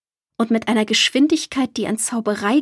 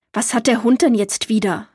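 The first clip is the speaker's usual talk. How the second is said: ordinary delivery, nothing marked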